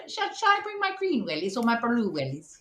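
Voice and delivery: light posh Cambridge student voice; a hint of a well-to-do Cambridge girl; posh Cambridge accent. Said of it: "My Cambridge one".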